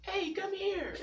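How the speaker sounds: put-on very high, tiny baby voice. Seventh grader calling the older girl in a baby voice. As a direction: squeak it out like a little kid. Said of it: "like, a baby voice".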